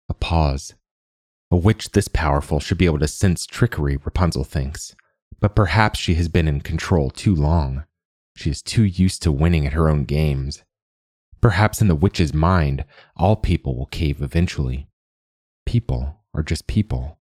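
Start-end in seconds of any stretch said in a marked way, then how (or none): none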